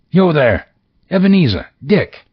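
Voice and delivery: high, loud jolly call across the room; jovial fat voice. Acted. Old Fezziwig calls his apprentices in a loud jovial voice. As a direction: hail the apprentices like a merry old boss. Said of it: "comfortable, oily, rich, fat, jovial voice".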